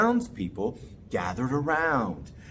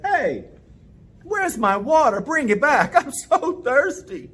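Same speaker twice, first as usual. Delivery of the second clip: higher, louder complaining man; angry man; 9 semitones higher, 4 dB louder than usual. Acted. Thirsty man scolding Daddy about his vanished water, indignant and louder. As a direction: demand the water back, indignant. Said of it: "The man was angry".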